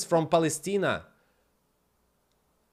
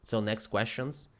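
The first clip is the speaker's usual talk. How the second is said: lower, softer dismissive mock voice; mock Trump brush-off; 5 semitones lower, 5 dB quieter than usual. Mocking Trump dismissing the reporter, low and dismissive. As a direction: brush her off, bored and smug. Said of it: "And he telling something like".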